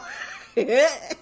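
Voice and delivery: high, helpless cackle; real laugh. Real, not acted. Storyteller amused by the memory, laughing high. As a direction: crack up at your own story. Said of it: "it's even funny now".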